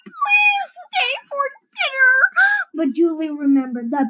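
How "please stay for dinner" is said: high pleading plea. Acted. David begging Julie to stay for dinner, high pleading. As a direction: beg her to stay.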